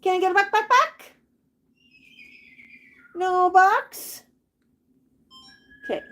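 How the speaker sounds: high sing-song coaxing of the parrot; high pet talk. Real, not acted. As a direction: coax the bird in a high lilting voice. Owner coaxing parrot to say buck.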